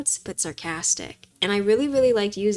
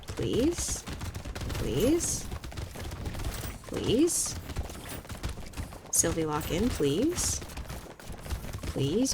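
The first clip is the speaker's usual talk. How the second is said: desperate pleading; much slower than usual. Real, not acted. Gamer begging to survive wave eighteen, desperate pleading. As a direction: plead with the game for your life.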